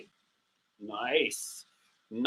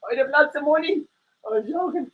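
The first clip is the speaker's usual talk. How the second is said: high defiant meerkat voice; cheeky puppet voice; 10 semitones higher than usual. Acted. Puppet meerkat mouthing off to its owner, high and defiant. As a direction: sass back as the toy, pitched up.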